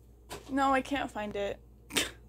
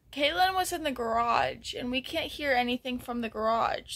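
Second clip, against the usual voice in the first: usual speaking voice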